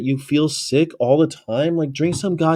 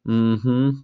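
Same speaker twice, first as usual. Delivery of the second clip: ordinary talk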